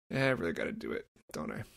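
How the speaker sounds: reluctant. Retold or imitated. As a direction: sink into a grudging admission. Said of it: "And I'm like".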